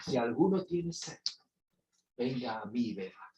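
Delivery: low, hushed offer with pauses; gentle invitation. Retold or imitated. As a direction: invite them in quietly. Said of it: "Jesús se grita y dice".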